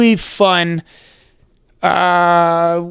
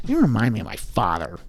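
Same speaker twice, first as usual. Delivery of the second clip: Heath Ledger's Joker; 8 semitones lower, 10 dB quieter than usual. Retold or imitated. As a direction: menace the listener like the Joker. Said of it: "I've always been able to do".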